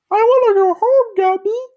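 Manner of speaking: very high, loud cartoon horse voice; squeaky puppet pitch way up; Pokey's high voice. A narrator's reading. Pokey begs Gumby to go home, high and loud. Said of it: "Pokey said".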